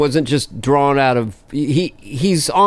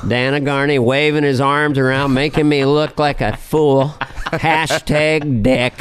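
Bush Senior. Acted. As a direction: drag out the last words in character. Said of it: "Bush Senior, imagine again".

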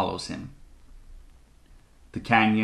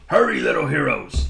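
higher, urgent character call; urgent shout; 4 semitones higher, 4 dB louder than usual. A narrator's reading. Horned toad waving the children forward, urgent shout. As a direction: hurry them along, calling out. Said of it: "he shouts".

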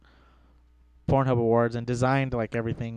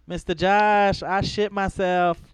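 put-on higher, drawn-out kid voice; lightly voiced needy child; schoolkid; 6 semitones higher, 4 dB louder, much slower than usual. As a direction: imitate a kid calling for teacher. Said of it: "They used to call me Mister Josh".

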